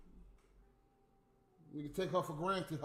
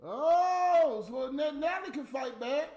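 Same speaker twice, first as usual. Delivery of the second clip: long loud, high "oh" into a taunt; mock surprise; 7 semitones higher, 5 dB louder, slower than usual. Real, not acted. Mocking reaction to Natalie's comeback.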